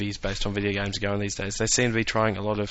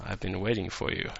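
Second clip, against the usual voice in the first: sinister villain voice; 3 semitones lower, 9 dB quieter than usual. Retold or imitated. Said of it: "big cloak and he's going".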